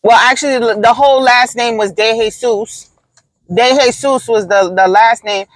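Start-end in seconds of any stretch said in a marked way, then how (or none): none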